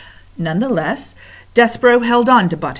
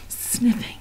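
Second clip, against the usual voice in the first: whisper; 5 semitones higher, 4 dB quieter than usual. A narrator's reading. Rats sniffing the air.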